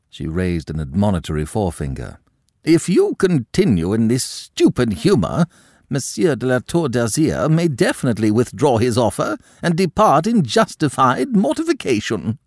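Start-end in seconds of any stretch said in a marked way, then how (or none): 2.6-12.4 high, loud, outraged aristocrat; indignant countess. A narrator's reading. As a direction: scold the girl with wounded pride. Madame warns Aline.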